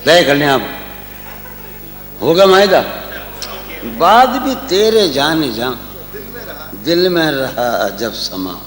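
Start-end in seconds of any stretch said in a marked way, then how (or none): 4.0-8.5 tender romantic poetry. Acted. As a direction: recite it lovingly, slowing down. Recites ghazal couplets.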